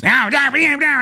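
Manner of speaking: high mock protest of an angry lady; shrill protest imitation. Angry bar lady protesting at the couple, high mock outburst. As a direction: bleat the no's like a furious stranger. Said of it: "and the lady just".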